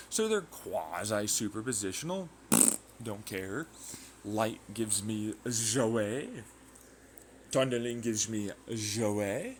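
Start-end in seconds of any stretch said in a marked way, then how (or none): 4.3-9.6 slow, drawn-out mock French; silly French accent; put-on French accent. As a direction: stretch it out in a fake French accent, lifting at the end. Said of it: "I'm not French".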